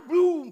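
loud mouth-made bang; booming kicked-chair noise; imitated crash. Woman kicks a chair, loud imitated crash. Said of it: "Patea una silla".

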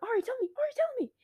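very high, pleading squeal of a friend; a playful high-pitched plea for news; squeaky begging friend. Retold or imitated. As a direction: beg to be told in a tiny high voice. Friend voiced begging to be told.

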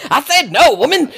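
high, loud comic protest; mock exasperated refusal. Worker refusing boss who leaves filing anyway, comic exasperation.

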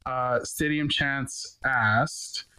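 ordinary talk, nothing marked

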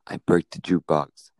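normal talking voice

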